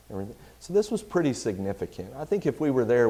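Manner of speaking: normal talking voice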